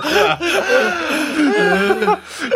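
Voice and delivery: long, high laugh that tips into crying; helpless laughter. Real, not acted. Laughing at the friend's joke, near tears. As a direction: laugh until you can barely breathe.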